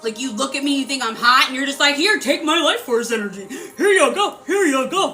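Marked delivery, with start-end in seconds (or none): 1.9-5.1 mock eager offering. Mocking those who give energy away freely, laughing and eager. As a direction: parody handing yourself over gladly.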